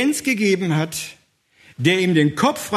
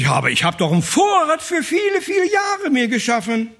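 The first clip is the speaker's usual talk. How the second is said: protesting farmer; 8 semitones higher than usual. Acted.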